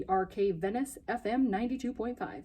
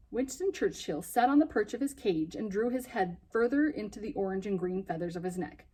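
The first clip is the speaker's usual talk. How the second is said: usual speaking voice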